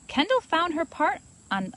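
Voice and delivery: plain reading voice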